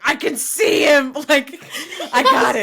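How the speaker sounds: loud, high eager driver yell; excited shout. Retold or imitated. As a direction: shout it over the team radio. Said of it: "Lewis is just like".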